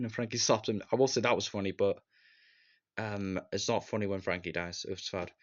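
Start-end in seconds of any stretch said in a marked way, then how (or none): none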